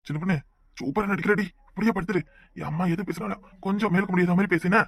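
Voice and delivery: conspiratorial hush to your wife; hushed aside. Acted. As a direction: say it under your breath to your wife. Husband coaching wife, mother nearby.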